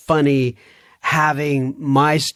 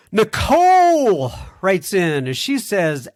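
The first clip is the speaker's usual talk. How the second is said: ordinary talk, nothing marked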